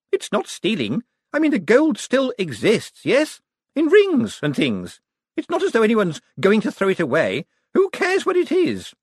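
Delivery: high, fast, scornful heiress rant; triumphant haughty woman. A narrator's reading. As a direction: lecture them with smug triumph. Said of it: "triumphantly plunged on".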